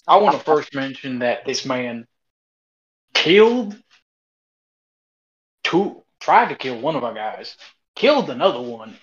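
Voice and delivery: put-on loud drawling southern accent; southern accent, pressing the question. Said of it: "I can do a southern accent much easier".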